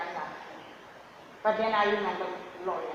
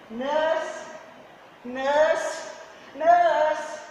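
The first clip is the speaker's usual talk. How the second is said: loud repeated calls for help, rising; calling out; 7 semitones higher, 10 dB louder, much slower than usual. Retold or imitated. Patient in labour calling the nurse, loud calls. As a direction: shout for the nurse down the hall.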